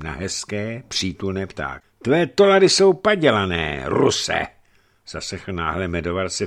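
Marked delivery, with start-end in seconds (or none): 2.0-4.5 high, raised mocking accusation. A narrator's reading. Hunchback accusing the Russian merchant in the market, mocking. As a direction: jeer it out, pitched up and dropping on the last word. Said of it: "v tichý, posměšný hlas".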